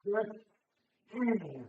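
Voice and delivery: straining grunt under the weight; effort grunt. Real, not acted. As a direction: push through with a grunt. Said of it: "sorry for the grunting".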